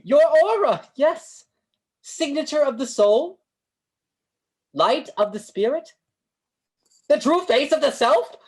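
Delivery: soaring high and loud, like an oracle; grand mystic proclamation. Acted. Fortune teller dazzles Charlie with aura talk, grandly proclaiming. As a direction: proclaim it to the heavens, hammed-up seer.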